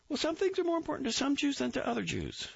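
high, eager recruit reporting; Grossbart voice. A narrator's reading. Recruit reporting to the captain, high eager voice. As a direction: snap it out high and keen.

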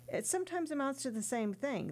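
ordinary delivery

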